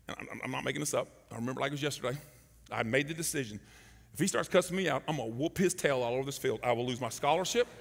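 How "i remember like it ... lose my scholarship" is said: usual speaking voice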